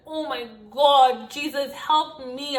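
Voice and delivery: slow, drawn-out mock prayer; acted desperate plea. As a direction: stretch out a panicked plea to God. Acted desperate prayer as door is rammed.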